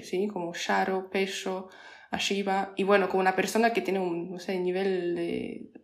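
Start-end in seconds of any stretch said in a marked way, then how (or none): 0.5-2.7 "charo, pecho, arriba" with SH for every RR; SHARO, PESHO, ASHIBA, hushing each double R. Shown as a demonstration. Listing Santiagueño examples for learners, RR as SH. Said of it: "en vez de decir doble R".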